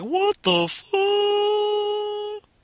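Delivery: mocking the staring pedestrians; imitating baffled passersby. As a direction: drag it out like a stunned stranger. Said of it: "they are looking at me like".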